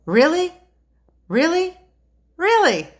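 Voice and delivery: mock astonishment, each Really? pushed up high; incredulous. Mock disbelief at Democrats.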